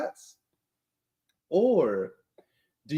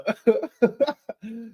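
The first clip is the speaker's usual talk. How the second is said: a falling laugh, higher than usual; 7 semitones higher than usual. Real, not acted. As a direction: laughs off the crude comment. Laughs at Chris's comment.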